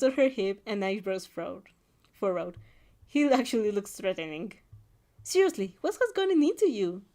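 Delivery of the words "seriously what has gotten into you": higher-pitched acted exasperation; exasperated huff. A narrator's reading. Friend confronts a self-doubter, exasperated. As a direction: demand an explanation in a huff. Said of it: "she hops".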